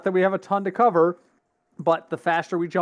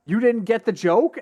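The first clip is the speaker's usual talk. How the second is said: incredulous question; 6 semitones higher, 4 dB louder than usual. Retold or imitated. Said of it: "he's like".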